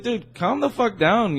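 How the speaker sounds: telling him to calm down. Retold or imitated. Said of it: "it was something like, "Calm down, you know, lose your tone".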